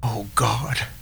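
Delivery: miserable breathless whisper; soft despairing whisper. A narrator's reading. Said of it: "and whispered".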